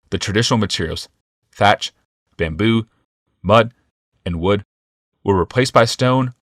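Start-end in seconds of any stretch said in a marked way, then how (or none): none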